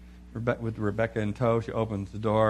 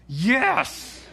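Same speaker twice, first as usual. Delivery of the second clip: high squeaky yes; emphatic yes; 11 semitones higher, 4 dB louder than usual. Retold or imitated. Wife confirming he must handle it, high emphatic yes. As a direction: pop it out high and sure.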